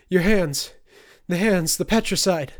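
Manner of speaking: high, very loud panicked cries; terrified shouting. A narrator's reading. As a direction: shriek out in terror at the hands. Marcus terrified of Turner's hands.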